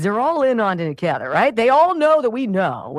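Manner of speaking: ordinary talk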